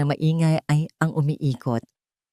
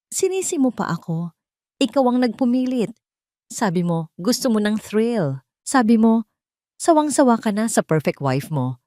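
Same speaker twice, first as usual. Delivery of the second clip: angry retort; 5 semitones higher than usual. Acted. Mistress fires back in quarrel.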